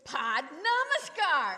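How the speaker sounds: slow, loud, insistent; emphatic. Retold or imitated. Swami loudly insists the singers bow. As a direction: spell it out firmly and slowly. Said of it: "and he goes".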